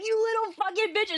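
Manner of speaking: high-pitched, fast mock rant; mock Keem rant. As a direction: squeal the insult like a ranting streamer. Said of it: "being like".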